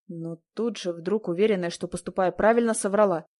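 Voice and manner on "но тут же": ordinary delivery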